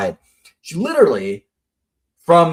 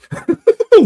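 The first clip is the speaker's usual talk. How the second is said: high, loud burst of laughter; 5 dB louder than usual. Real, not acted. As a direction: crack up at your own joke. Laughing at own grim joke.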